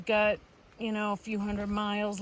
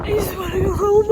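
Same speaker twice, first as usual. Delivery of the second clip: high, loud tearful plea; crying; 11 semitones higher, 9 dB louder than usual. Real, not acted. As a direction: voice breaking, sobbing. Breakdown continues.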